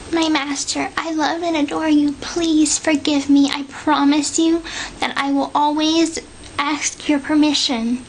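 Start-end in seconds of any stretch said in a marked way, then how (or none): none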